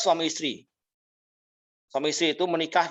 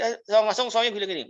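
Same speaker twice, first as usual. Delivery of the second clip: ordinary talk, nothing marked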